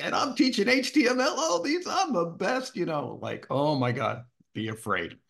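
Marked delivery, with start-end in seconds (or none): 0.0-2.7 bragging, pitched way up; cocky self-quote. Retold or imitated. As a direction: crow like you own the room. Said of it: "I'm like".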